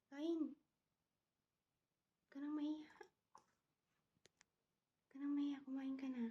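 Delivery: coaxing a pet. Real, not acted. Owner urges shy pet bird to eat, coaxing.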